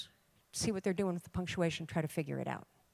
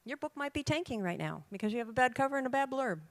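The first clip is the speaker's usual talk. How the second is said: ordinary delivery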